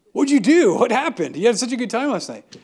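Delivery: high, loud, eager questions; a friend who can't wait to hear what happened, high and loud; eager curiosity. Retold or imitated. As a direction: burst out wanting to know everything. Friend eagerly asks about night.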